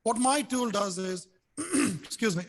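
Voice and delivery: usual speaking voice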